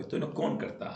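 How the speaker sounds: ordinary talk, nothing marked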